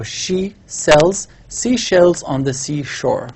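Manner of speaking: slow, careful tongue twister; slow demo of the correct tongue twister; careful model reading. Shown as a demonstration. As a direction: spell out each word slowly as the correct model. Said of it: "This is the correct one".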